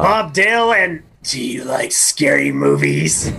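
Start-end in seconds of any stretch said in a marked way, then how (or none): 1.2-3.3 creepy horror voice. Acted. Quotes horror film prank line.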